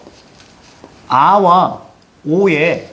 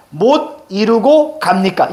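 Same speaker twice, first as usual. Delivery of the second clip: "못 이루고 갑니까" slow and higher, each word set apart; stems picked out; 4 semitones higher, slower than usual. Shown as a demonstration. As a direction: point out each stem in "못 이루고 갑니까", slowly. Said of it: "어간의 포인트를 찍으면서 읽어가시는". ("못 이루고 갑니까"를 어간마다 짚으며 천천히 읽기)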